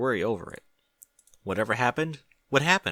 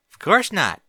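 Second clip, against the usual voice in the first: put-on much higher, louder young filly voice; Apple Bloom voice; 9 semitones higher, 9 dB louder than usual. A narrator's reading. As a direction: pitch it up as an eager young filly.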